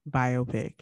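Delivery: Biopic as BYE-oh-pick; Biopic sounded as bio plus pick; American pronunciation. Shown as a demonstration. Co-host reading a pronunciation guide, American BYE-oh-pick. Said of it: "American pronunciation".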